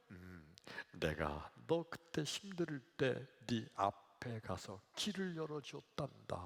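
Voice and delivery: soft, unhurried explanation; gentle voice of Jesus. Acted. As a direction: speak tenderly as Jesus to a troubled believer. Acted Jesus explaining the footprints. (조용하고 인자하게)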